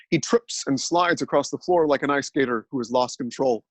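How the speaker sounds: usual speaking voice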